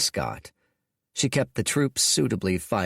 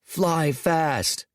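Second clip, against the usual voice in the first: shouted; 7 semitones higher than usual. A narrator's reading. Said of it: "shouted Dorian".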